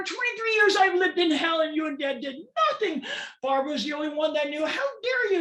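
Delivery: high, loud, enraged outburst at mom; furious screaming. Retold or imitated. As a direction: scream it at her, years of rage pouring out. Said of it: "I'm screaming at her".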